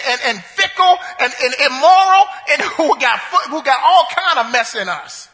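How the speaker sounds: rousing sermon delivery; full-force preaching. Acted. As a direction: preach it out to the church.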